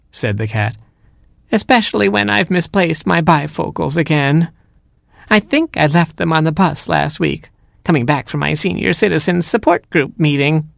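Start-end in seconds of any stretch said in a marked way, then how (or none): 1.5-10.7 put-on high, chatty old granny; cat posing as grandma. Cat makes excuses to Robin as grandma, high mock-granny voice.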